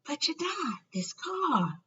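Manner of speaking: high, soft puzzled Harry; boy putting pieces together; Harry bewildered. A narrator's reading.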